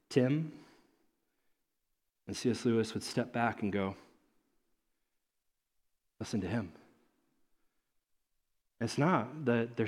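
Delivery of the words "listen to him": hushed repeat. Retold or imitated. Lewis voiced stepping back.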